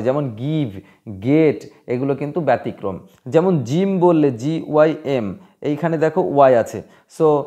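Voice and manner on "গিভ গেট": গিভ and গেট with a hard g; hard G exceptions. Shown as a demonstration. Teacher giving exceptions to the rule, demonstrating hard-G গিভ and গেট. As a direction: say গিভ, গেট apart, each starting on hard গ. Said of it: "তবে এর ক্ষেত্রে ব্যতিক্রম আছে".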